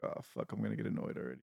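muttered annoyed aside; hushed inner groan; whispered aside. Retold or imitated. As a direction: whisper it under your breath. Inner thought quoted as aside.

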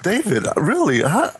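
high, hammed-up scandalized protest; mock outrage. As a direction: scold him in pretend horror. Feigned outrage at the host's question.

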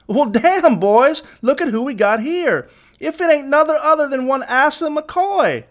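very high twangy crowing; high, gleeful Southern gang leader. A narrator's reading. Leader crows on recognizing Asa.

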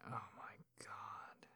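breathy, close whisper into the ear; hushed, heated bedroom whisper; intimate whisper. Acted. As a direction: whisper it like a lover pressed close.

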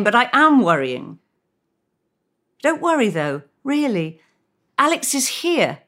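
a friend awkwardly breaking news; hesitant friend. Acted. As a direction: soothe her while hiding something. Reads Lydia hesitant reply.

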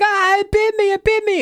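very high acted scream; screaming in pain. Sniffles runs out bitten, screaming. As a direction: shriek it like a bitten creature. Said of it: "he's screaming".

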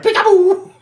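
very high, loud mock "boo"; spring it on the listener as a joke scare; playful high-pitched shout; mock jump scare. Imitating one last jump scare.

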